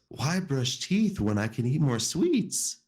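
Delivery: high, slow monster voice; Fuzzy the monster. Acted. Storyteller voicing Fuzzy defending his sweets, high and slow. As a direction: stretch it out like a greedy little monster. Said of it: "So Fuzzy says".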